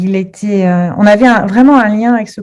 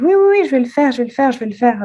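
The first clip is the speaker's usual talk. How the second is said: higher-pitched, repetitive mock reassurance; hasty assurance; 6 semitones higher than usual. Witness quoted promising to call police, hastily reassuring. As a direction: imitate someone brushing off a request with eager promises.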